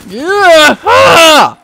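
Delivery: high, loud drawn-out cries; panicked screams. Real, not acted. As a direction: scream out under attack. Attacked in the game.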